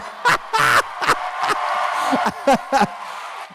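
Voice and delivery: big laugh bursting out high. Real, not acted. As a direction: let out a long, high laugh. Host breaks into loud laughter.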